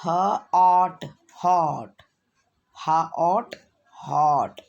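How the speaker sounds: ह and ऑट sounded apart, then joined as हॉट; phonics blending. Shown as a demonstration. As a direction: say short 'huh', stretched 'awt', then blend into हॉट. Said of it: "तो क्या बन गया".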